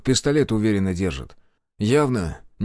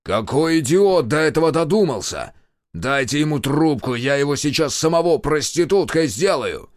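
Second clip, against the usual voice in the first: raised-pitch, blustering rage; furious roar; 5 semitones higher, 4 dB louder than usual. A narrator's reading. Official demands the idiot on the phone, roaring. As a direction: bellow threats down the line. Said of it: "Рёв невидимого Дмитрия Владимировича оглушал".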